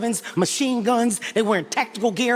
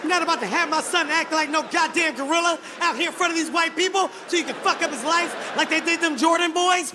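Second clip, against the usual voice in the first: raised-pitch angry protest; indignant refusal; 5 semitones higher than usual. Retold or imitated. Father refusing to let his son act like a gorilla, acted outrage. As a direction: refuse outright, high and emphatic. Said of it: "I got mad".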